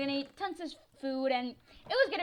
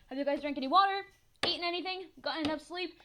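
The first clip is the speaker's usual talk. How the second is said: ordinary talk, nothing marked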